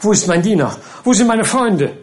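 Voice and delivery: confused calling. Acted. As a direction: call out lost and confused. Pickwick wakes confused. (verwirrt rufend)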